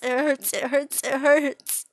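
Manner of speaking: loud, high cry of pain; agonized scream. Acted. Victim under the knife, screaming in agony. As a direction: scream it out at the peak. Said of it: "from screaming".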